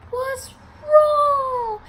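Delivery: very high, loud, drawn-out cooing; imitated concerned manager. Manager trying to hug crying employee, high drawn-out concern. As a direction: mimic an alarmed, fussing manager. Manager consoling her, voiced.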